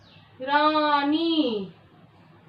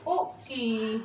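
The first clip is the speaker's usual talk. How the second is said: a word or two, then a very long pause; halting, drawn-out word-by-word delivery; slow dictation; 3 semitones lower, 8 dB quieter, slower than usual. Shown as a demonstration. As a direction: dictate piece by piece for someone writing along. Dictating definition while writing it out.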